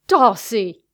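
loud, sharp angry call; angry interruption. A narrator's reading. Man interrupting a forced kiss, angry shout. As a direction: call the name out in anger. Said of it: "An angry male voice interrupted them".

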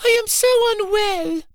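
hammed-up sobbing for pity; fake sob. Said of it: "she faked a little sob".

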